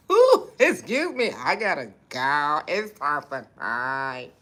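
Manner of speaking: sing-song exit in a cartoon voice; hammed-up high goodbye; mock high sign-off. Signing off in put-on voice.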